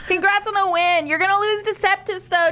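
high-pitched taunting tween; mean tweens. Acted. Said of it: "mean-looking tweens".

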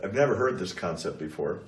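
ordinary talk, nothing marked

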